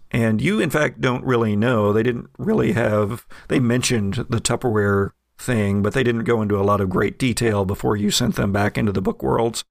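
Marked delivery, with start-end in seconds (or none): none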